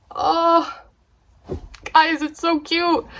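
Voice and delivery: high, cooing squeal; gushing up high to viewers; excited gush. Real, not acted. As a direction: coo over how cute it is. Gushing at camera.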